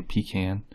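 put-on pecan as PEE-can, like older folks; old people's pecan. Shown as a demonstration. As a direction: say pecan with a long first syllable, PEE-can. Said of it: "only old people I've really heard say".

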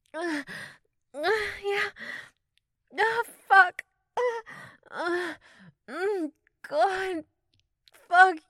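high, loud moans of pleasure; rising cries of pleasure. Acted.

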